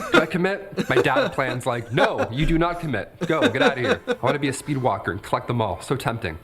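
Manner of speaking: ordinary talk, nothing marked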